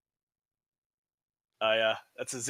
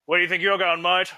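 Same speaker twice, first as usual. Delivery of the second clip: tough guy challenge; 5 semitones higher, 7 dB louder than usual. Acted. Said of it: "just be like".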